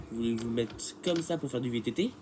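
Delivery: ordinary delivery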